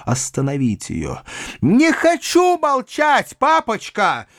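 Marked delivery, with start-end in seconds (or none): 1.6-4.3 put-on high, drawn-out girlish shout; high-pitched rebellious outburst; girl's defiant cry. A narrator's reading. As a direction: cry out in a high child's voice, stretching the words. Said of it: "закричала Саша".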